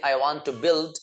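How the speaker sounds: very slow, every word spelled out for learners; slow model sentence. Shown as a demonstration. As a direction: say it slowly so students can repeat. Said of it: "teacher models English sentence". (बहुत धीरे, साफ़ बोलकर)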